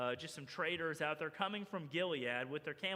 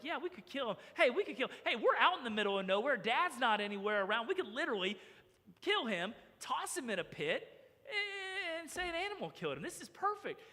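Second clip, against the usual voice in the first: raised pitch, eager plotting; excited scheming; 6 semitones higher, 4 dB louder than usual. Acted. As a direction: act out a brother warming to a wicked plan. Brothers egging each other on.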